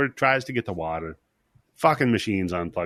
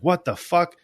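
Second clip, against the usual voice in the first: sudden shocked swear, pitched up; startled higher yelp; freaked-out outburst; 7 semitones higher than usual. Retold or imitated. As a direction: jump in alarm at the sight. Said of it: "Freaks out".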